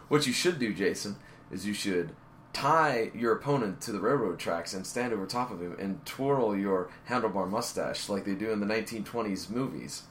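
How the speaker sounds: jokey movie villain. Retold or imitated. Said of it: "And jokingly".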